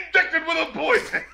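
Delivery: high-pitched mock shout; imitated shouted tag. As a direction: imitate a jarring producer tag. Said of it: "It's just, like, shouted".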